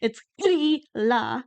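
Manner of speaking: Grýla drawn out slowly with a rolled r; Grýla said slow and clear, GREE-la; slow correct Icelandic. Shown as a demonstration. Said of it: "That's how you say it".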